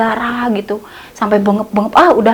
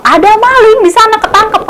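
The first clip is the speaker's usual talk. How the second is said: excited report; 10 semitones higher, 5 dB louder than usual. Retold or imitated. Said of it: "katanya kayak gitu".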